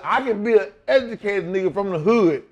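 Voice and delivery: acted-out proud pushback; indignant insistence. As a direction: argue back at the boss. Acted refusal to promoter.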